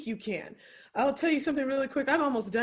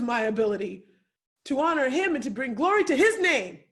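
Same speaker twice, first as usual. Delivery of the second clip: defiant preaching; 6 dB louder than usual. Real, not acted. Speaker defying her doubters: loud fiery preaching.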